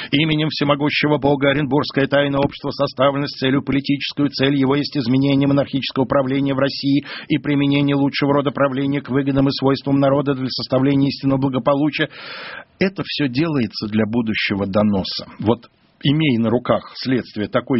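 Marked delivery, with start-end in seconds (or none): 0.1-12.1 level, unbroken monotone read-out of a charter; зачитывает документ. Acted. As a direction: recite the document on one pitch without pauses. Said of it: "вымышленные документы этого общества". (монотонно зачитывает устав тайного общества)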